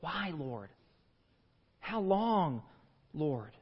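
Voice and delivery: soft, low, drawn-out lament; quiet anguished plea. Acted. Preacher giving voice to the congregation's cry, quietly anguished. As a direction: let each word sink as if worn out by waiting. Voicing everyone's question to God.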